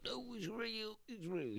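high, soft, strained mock plea; imitated pained pleading. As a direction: act out insisting the pain is real. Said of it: "she thinks he's faking it".

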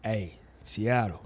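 mock the smooth soul brother croon; imitating his put-on deep voice to fans. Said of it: "He would put on his deep voice".

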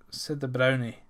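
plain reading voice

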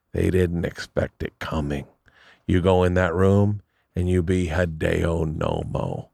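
slow, softly landed motivational punchline; Barry's voice. Acted. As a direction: imitate a manager slowly handing down advice. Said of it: "this is what he sounds like".

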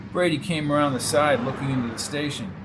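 ordinary delivery, nothing marked

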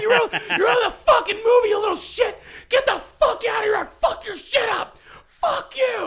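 full-voiced, high-pitched mock tirade; imitated screaming. Dude throwing a kid out of the movie, imitated screaming. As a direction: shout it all out like an enraged moviegoer. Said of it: "Just screamed at him".

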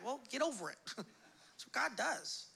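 ordinary talk

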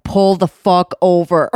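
loud cop bark over the speaker; cop yelling. Retold or imitated. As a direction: yell it like a furious cop. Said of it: "the guy was like".

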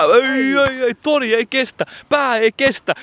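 high, anguished no no no; despairing commentator. Pretend. Commentator wails that Toni cracks under pressure. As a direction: lament the miss at a high pitch.

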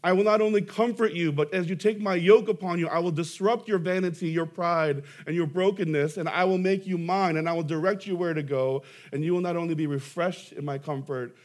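fervent sermon proclamation; preaching at full force. Acted. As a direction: proclaim it boldly from the pulpit. Preacher voicing Christ's words.

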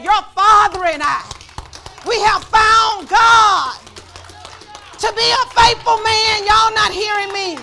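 high, loud, very slow sermon shout; shouted preaching. Acted. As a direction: stretch every word out to the back row.

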